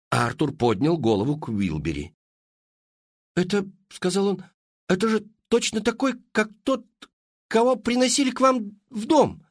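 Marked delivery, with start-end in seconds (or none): 3.3-9.4 put-on excited boy. Взволнованный Артур узнаёт тролля.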